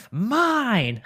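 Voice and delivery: loud, high-pitched drawn-out yell; imitated yell. Host imitating Fisk beating Adam, high loud yell. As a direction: yell it like a possessive little kid. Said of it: "he yells".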